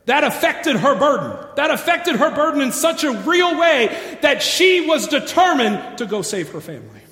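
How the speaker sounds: loud, high, driving sermon climax; preaching at full force. Acted. Pastor urging the church to save their families, loud preaching. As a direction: preach it hard, pleading with the church.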